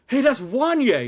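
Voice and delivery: high, loud imitation of an excited fan; excited calling out. Friend spots a singer at the Galleria, calling out excitedly. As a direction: holler it like a starstruck friend.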